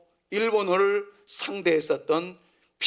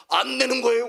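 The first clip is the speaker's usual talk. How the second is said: raised, louder Gyeongsang-style retort; mock heated comeback, higher and louder; imitated angry denial; 7 semitones higher, 5 dB louder than usual. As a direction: deny being angry while sounding angry. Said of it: "근데 더 화를 내시더라고요".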